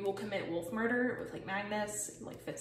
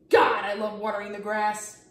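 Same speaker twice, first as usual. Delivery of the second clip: character quote; 11 dB louder than usual. Pretend. Voicing a lawn-watering drawn character.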